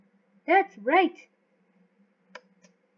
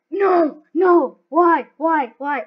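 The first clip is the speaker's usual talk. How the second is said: loud, anguished protest; dismayed yelling; 9 dB louder, much slower than usual. Real, not acted. Gamer after a sudden failure, dismayed yelling. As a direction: yell each word in dismay. Fails in the game.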